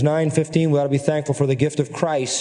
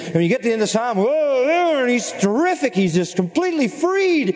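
much higher, ringing with wonder; excited marvel; 9 semitones higher than usual. Real, not acted. Preacher exulting over the freed psalmist, much higher. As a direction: lift way up in delight at the psalm's end.

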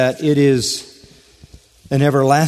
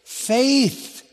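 high ringing answer; 12 semitones higher than usual. Acted. Answering his own question.

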